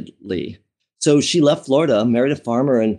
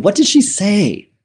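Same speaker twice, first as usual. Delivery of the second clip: high, drawn-out retold question; lightly acted alarm, pitched up and stretched out; anxious questioning; 8 semitones higher, 3 dB louder than usual. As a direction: press for an answer, voice climbing. Son questions his crying mother, retold.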